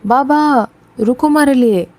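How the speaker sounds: calling after. A narrator's reading. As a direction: call out to stop him. Boy runs after father calling. (पीछे भागते हुए बाबा को ऊँची आवाज़ में पुकारना)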